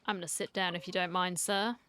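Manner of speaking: ordinary talk, nothing marked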